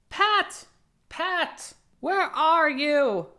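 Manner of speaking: high, loud angry yell, slow on the question; furious rabbit hunting for his servant; angry calling. Acted. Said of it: "Next came an angry voice".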